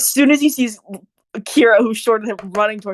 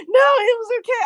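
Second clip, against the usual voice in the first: higher, squeaky-pitched excuse; pleading protest; 11 semitones higher, faster than usual. Acted. Hyden defending himself to Kira, high pleading protest.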